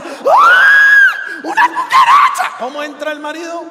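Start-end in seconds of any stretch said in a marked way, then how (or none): 0.0-2.6 very high, loud screeching wife; wife's shriek. Retold or imitated. Wife in the bedroom screams at a cockroach, high screech. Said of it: "la esposa grita desde la pieza".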